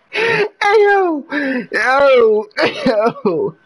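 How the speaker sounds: shrill yelling near a cry; high excited yells. Real, not acted. Streamer yelling in wild excitement at the screen. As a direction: shout out in wild hype. Screaming hype at the game.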